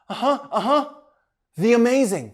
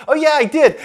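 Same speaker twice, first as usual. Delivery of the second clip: high, loud "Oh, yeah, I did."; sudden excitement; 7 semitones higher, 8 dB louder than usual. A narrator's reading. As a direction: catch yourself and cheer. Ed realizes he won.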